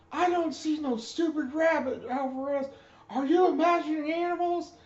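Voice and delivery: barked shout, way up high and loud; high, loud drill instructor shout; drill instructor yell. Retold or imitated. Drill instructor mocking recruit.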